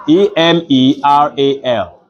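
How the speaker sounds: name each letter slowly for the board; spelling letter by letter. Shown as a demonstration. Teacher spelling a word aloud to the class, letter by letter. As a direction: spell "ephemeral" as slow, separate letters. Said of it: "am I correct".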